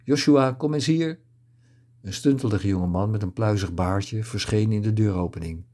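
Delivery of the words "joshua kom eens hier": calling through cupped hands. A narrator's reading. Doctor calls his son from the hall, cupped-hand call. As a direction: shout across the hospital to your son. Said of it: "riep door de trechter die hij zo vormde".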